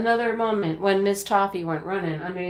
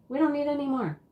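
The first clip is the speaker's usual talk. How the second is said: ordinary delivery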